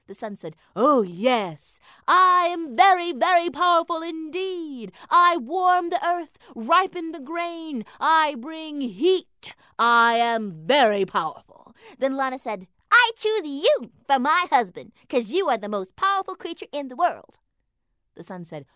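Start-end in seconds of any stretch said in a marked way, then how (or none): 0.7-11.7 booming proud sun. A narrator's reading. Sun character boasting. 12.9-17.4 eager little mouse. A narrator's reading. Lana mouse speaking.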